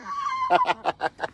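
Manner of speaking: short high shriek of laughter; high cackle. Real, not acted. Laughing at the corn offering.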